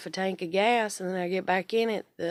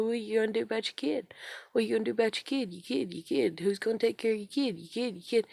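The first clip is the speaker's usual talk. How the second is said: fast, higher nagging voice; mocking parents; 3 semitones higher, faster than usual. Parents nagging her about her kid, fast mocking singsong.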